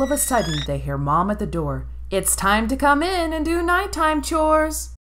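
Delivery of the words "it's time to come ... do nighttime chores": high, distant mother calling in; mom calling. Acted. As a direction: call the kids inside. Mom calls from door.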